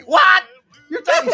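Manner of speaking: high, loud laughing disbelief; stunned and amused; mock shock. Grandson retelling grandma talking to Shelby, high laughing shock.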